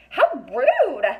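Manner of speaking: a prim fairy taking offence; offended. A narrator's reading. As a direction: sniff it out indignantly. Council scolds boy.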